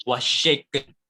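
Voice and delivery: ordinary talk, nothing marked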